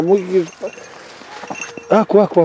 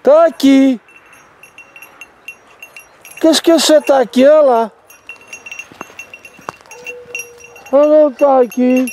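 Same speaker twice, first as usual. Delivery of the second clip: higher drawn coaxing call; sing-song calling; 6 semitones higher, slower than usual. Real, not acted. Owner calling the rooster Τάκης, higher coaxing. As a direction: coax him like calling a pet.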